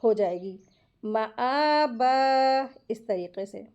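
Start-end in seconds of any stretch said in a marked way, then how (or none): 1.0-2.7 मा आ बा with the alif held two beats and a stop on बा; slow recited मा आ बा, long a, ending on a pause; Quranic recitation. Shown as a demonstration. Teacher demonstrates मा आ बा in pausal form. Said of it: "वफ की सूरत में".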